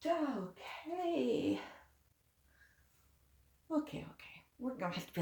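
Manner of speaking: whispered to herself; whispering. Real, not acted. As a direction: whisper it to calm down. Calming self after spill.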